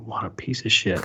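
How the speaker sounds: whispered under the breath; inner thought. Retold or imitated. Thinking it silently about Rob Liefeld, whispered. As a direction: hiss it to yourself. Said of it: "in my mind, I'm just thinking".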